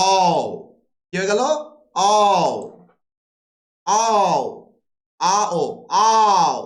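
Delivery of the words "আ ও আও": আ ও split apart, then আও glided and louder; diphthong demo. Shown as a demonstration. Teacher showing students the glide, louder. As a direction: build আও from its two vowels. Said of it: "দেখো আও".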